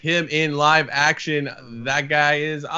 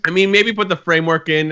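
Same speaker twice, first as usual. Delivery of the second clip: ordinary talk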